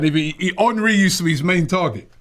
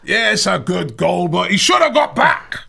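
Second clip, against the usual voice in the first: slow, higher grudging Scottish moan; mock Scottish fan, rising at the end; bad Scottish accent; 3 semitones higher, 4 dB louder, slower than usual. Scottish fan grudging Henry's good goal, mock Scottish accent. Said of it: "That's not a very good Scottish accent".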